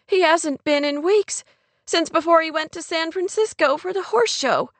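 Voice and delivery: high, upset explanation; trembling, blurted. A narrator's reading. Kate explains the broken engagement, upset. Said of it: "her voice trembling".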